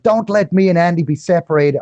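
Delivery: high, pleading mock prayer in a bad accent; Field McConnell. Praying not to be separated from Andy, badly imitated accent. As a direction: plead to God in a terrible put-on accent. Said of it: "for the terrible accent".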